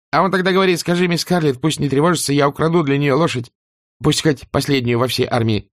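put-on high, quick breathless servant girl; chattering maid. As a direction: babble it out in a rush. Prissy continues.

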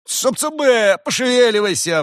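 high-pitched, full-force drover's holler; booming shout. A narrator's reading. Farmer hurrying his oxen, loud booming shout. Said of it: "вновь прозвучал зычный голос".

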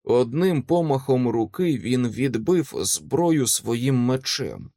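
usual speaking voice

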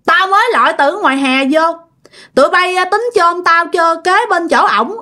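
put-on a possessed grandmother scolding her children; stubborn grandma. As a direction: protest the burial plan. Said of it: "còn có bữa".

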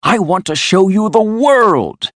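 high, eager persuasion; insistent coaxing. A narrator's reading. Toad pleads with Rat to come, insistent and high. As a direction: urge him on, swelling at the end.

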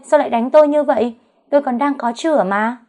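high, loud, fast put-on wail; loud theatrical shriek, pitch up and quick; fake wailing cry. A narrator's reading. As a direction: rush the howl out, playing the victim. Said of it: "rồi gào lên".

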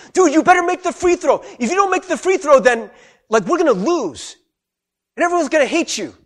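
loud, high-pitched haranguing; pressuring shout. Acted. Crowd to a player at the free throw line, shouted pressure. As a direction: pile the pressure on at full volume.

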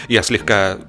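plain reading voice

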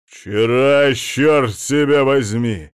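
loud exasperated curse; husband cursing at his scatterbrained wife, loud; angry outburst. Acted. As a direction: snap it at her, furious.

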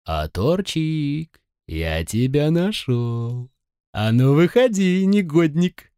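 high, slow, taunting sing-song; snide mocking voice. A narrator's reading. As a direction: tease him like a sneering bully. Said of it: "раздался всё тот же ехидный голос Слоуна".

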